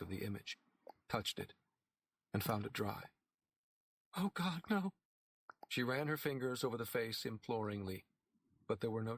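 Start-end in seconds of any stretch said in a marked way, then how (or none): 4.2-4.9 higher anguished moan; a sick woman's hope collapsing; despairing. A narrator's reading. Woman finds statue dry.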